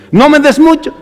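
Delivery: impassioned appeal, voice lifted on mucho; pleading cry. Retold or imitated. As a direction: cry out no me des mucho to the Lord. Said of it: "así como oraba Salomón".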